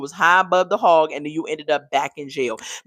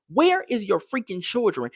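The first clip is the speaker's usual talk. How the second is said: normal talking voice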